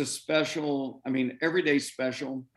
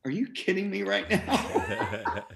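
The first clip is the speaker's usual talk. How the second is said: slow, higher, breaking into a laugh; amazed disbelief; 6 semitones higher, slower than usual. Retold or imitated. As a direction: marvel at it, drawn out. Retells seeing own name on uniform.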